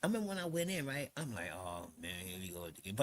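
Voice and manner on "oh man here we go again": low, quiet retold sigh of resignation; under the breath, resigned; resigned mutter. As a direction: mutter it low, weary of it all. Retold weary reaction going in.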